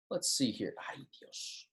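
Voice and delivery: barely audible whisper to self; hushed, voiceless little aside; muttered aside. Real, not acted. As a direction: whisper it under your breath. Muttering to self while fiddling with whiteboard.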